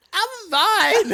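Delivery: mimicking a drunk friend, high-pitched; drunken insistence. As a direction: blurt it high, as a hammed-up drunk.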